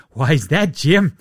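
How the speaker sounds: a hint of an angry commissioner; mock Adam Silver. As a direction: ham up an exasperated league boss. Adam Silver imagined dialogue.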